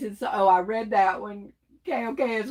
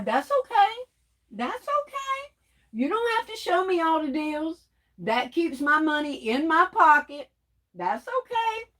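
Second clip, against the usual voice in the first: much higher sing-song "that's okay"; mock gracious; 6 semitones higher than usual. Real, not acted. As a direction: shrug it off sweetly, tongue in cheek. Mock gracious over hidden deals.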